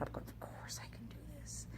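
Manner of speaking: breathy inner-voice whisper; ego whisper. Retold or imitated. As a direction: hiss it as your ego's secret boast. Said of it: "And my ego came out".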